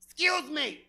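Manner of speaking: high, hot-mouthed tweaker voice. Retold or imitated. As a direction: talk like your mouth is burning from a pizza roll. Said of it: "why do all tweakers talk like".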